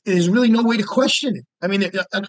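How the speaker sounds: ordinary talk, nothing marked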